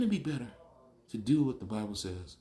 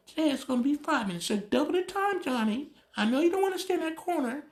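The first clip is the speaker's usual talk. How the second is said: much higher, sing-song voice of a pushover mom; mocking soft parent. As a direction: mimic a too-gentle mom begging her son. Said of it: "those of you ignorant parents say".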